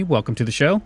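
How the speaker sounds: ordinary delivery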